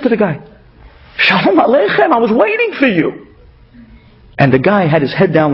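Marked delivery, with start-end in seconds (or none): 1.2-3.3 high, bright greeting to a guest at the door; eager welcome. Retold or imitated. Host welcoming a late-night visitor at the door, high and eager. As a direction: welcome him like you've been waiting all night.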